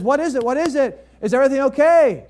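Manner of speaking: high, loud, urgent questions; alarmed asking. Retold or imitated. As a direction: rush in worried and breathless. Associate pastor runs up worried.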